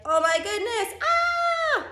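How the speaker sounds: high, loud cry rising to a squeal; exasperated mock outburst. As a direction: act out a dramatic freak-out. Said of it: "So he's like".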